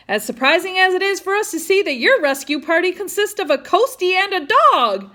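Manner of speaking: high, loud shout over the wind; yelling back. Acted. Twin brother yelling back at Hogan on the boat, high and loud. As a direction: yell it back teasingly. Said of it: "one of the brothers yelled back".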